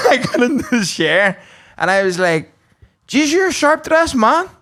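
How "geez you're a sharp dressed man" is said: high-pitched impressed call-out; retold admiring exclamation. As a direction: pitch it up high, complimenting a stranger. Greeting a stranger in retold scene.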